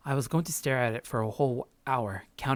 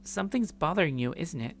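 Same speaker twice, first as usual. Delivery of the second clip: put-on higher, bright friendly young woman; Grace's voice; 6 semitones higher than usual. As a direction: play Grace as cheerful and curious.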